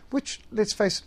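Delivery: normal talking voice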